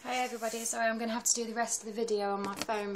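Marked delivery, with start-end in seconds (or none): none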